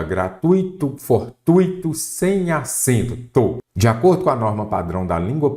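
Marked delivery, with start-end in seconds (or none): 0.0-1.9 "Gratuito, fortuito" with a high, stressed TUI; gra-TUI-to, for-TUI-to for "Gratuito, fortuito"; diphthong stressed on u. Shown as a demonstration. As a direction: ui as one syllable in "Gratuito, fortuito". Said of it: "O correto é".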